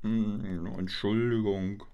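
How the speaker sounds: soft, low Entschuldigung; mumbled apology. Acted. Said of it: "Ich hab nichts verstanden". (leise genuscheltes Entschuldigung)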